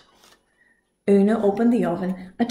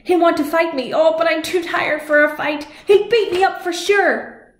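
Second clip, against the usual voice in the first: higher, fretful complaint; worried whine; 7 semitones higher, 4 dB louder than usual. Acted. Finn frets to Una about the fight. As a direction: whine that you're too tired.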